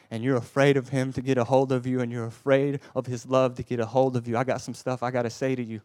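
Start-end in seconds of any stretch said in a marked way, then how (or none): 0.1-4.4 louder, building preacher intensity; preaching build. Acted. Preacher to congregation, loud urgent build. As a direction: press the fear home to the congregation.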